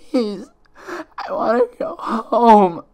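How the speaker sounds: high, loud, slow crying plea; sobbing stammer. Acted. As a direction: weep out the words, begging to go home. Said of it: "Twilight stammered".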